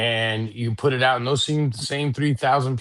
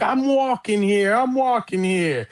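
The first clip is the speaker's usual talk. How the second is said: imitating Dustin Hoffman, higher and slow; mock New York shout at the taxi; 9 semitones higher than usual. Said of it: "as he's walking down the street and the taxi cab comes and hits him".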